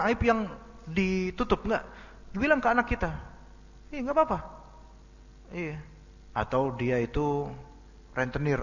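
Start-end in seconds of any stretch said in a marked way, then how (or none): 3.9-4.4 put-on much higher little kid's reply; lightly voiced child saying it's fine; child's voice. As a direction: play an easygoing small child.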